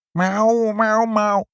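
high, loud cat meows; cat meowing. Acted. Cats telling mother important news, meowing. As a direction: yowl it like an urgent cat.

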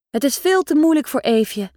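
ordinary delivery, nothing marked

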